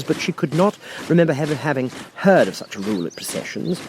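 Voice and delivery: ordinary delivery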